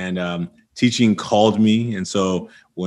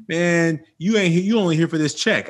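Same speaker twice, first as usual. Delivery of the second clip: taunting student; 7 semitones higher than usual. Retold or imitated. Student quoted taunting teacher.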